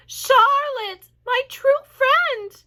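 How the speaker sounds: high, loud and slow grieving moan. A narrator's reading. Wilbur grieving over Charlotte, loud high moan. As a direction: stretch it out in sorrow. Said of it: "he moaned".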